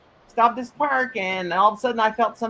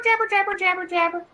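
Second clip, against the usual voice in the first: singsong teasing; 8 semitones higher than usual. Real, not acted. Teasing about chatter.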